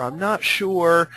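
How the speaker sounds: hesitant, drawn-out unsure answer; unsure clerk. Retold or imitated. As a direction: sound sheepish and indecisive. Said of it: "sheepish and indecisive and unsure".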